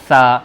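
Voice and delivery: سا said loud with a th sound, tongue between teeth; emphatic سا, th-like. Shown as a demonstration. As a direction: push سا out over the tongue tip. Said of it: "دانتوں کے بیچ میں جیب رکھ کر اس کو نکالیں گے".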